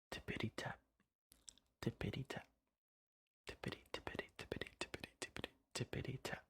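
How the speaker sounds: soft hoofbeats, speeding up at the end; whispered hoofbeats. Acted. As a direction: tap out little hooves on the path. Goat's hooves on the path.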